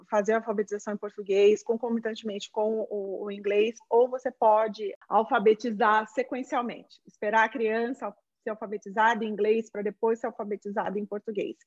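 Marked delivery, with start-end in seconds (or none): none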